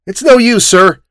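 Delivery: a flat, stubborn no to his elder; boy's defiant reply. Acted. Boy refuses the old man.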